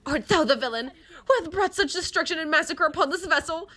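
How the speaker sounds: high, loud heroic knight voice; rally the knights, high and bold; Don Quixote voice. Acted. To the party, a high bold knight rallying.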